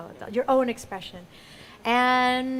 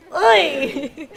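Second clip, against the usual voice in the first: loud, punchy yelp of support; short cheer grunt; 4 semitones higher, 12 dB louder than usual. Shown as a demonstration. Audience practice after one, two, three, loud short cheer. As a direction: let out one emphatic cheer. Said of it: "you can go".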